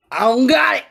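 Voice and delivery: loud, high, cheeky blurt; blurted confession. Acted. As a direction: shout it out just before bolting. Joke's man blurts before fleeing.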